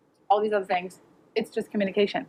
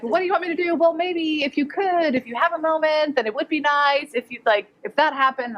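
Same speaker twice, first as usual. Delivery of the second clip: vague polite ask; 6 semitones higher than usual. Retold or imitated. Brazilian in-law's vague request.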